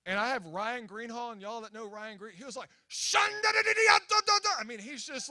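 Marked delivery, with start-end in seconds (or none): 2.9-4.6 high, loud, rapid gibberish like speaking in tongues; mock speaking in tongues. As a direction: imitate a friend babbling in tongues, fully into it. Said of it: "he's getting all into it".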